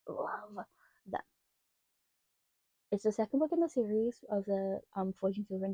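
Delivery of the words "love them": breathy whispered "Love them", falling. Real, not acted. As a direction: whisper it like a secret crush. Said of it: "whispered gush after laugh".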